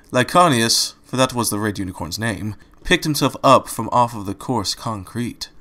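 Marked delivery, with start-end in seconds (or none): none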